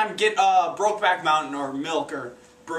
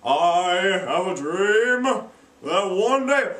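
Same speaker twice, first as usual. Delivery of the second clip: slow, grand preacher-like delivery; solemn orator; 4 dB louder, much slower than usual. Acted. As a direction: stretch it out like a grand sermon. MLK giving his speech.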